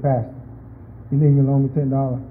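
the brother asks his pastor for money after giving it all; lightly voiced sheepish brother. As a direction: ask for the loan like a shy borrower. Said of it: "he said".

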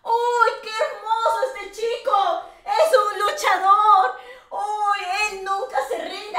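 high, gushing praise with a fake sob; mock gushing. Streamer gushing to chat about the boy, hammed-up tearful praise. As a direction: gush over the boy like a proud aunt.

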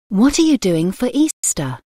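ordinary delivery

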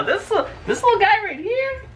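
put-on very high cooing baby talk; cutesy baby talk. Host coos at the creepy spider drawing, squeaky baby talk. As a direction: coo at it like a cute pet.

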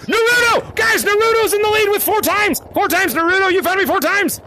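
high, excited calling out; excited announcement. Real, not acted. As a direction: announce the leader to the whole crowd. Announcing the game's leader to everyone.

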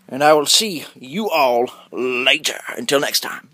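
slow, stretched farewell; drawn-out sign-off. Pretend. Vlogger signing off to viewers, slow drawn-out farewell. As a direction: draw each word out to the end.

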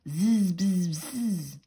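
loud bee buzz; buzzy housefly. Acted. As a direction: buzz it out like a bumblebee. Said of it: "said the bumblebee".

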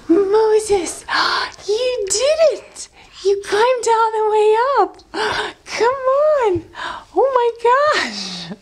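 high, near-tearful cheering; excited praise. Real, not acted. Baby reaches top.

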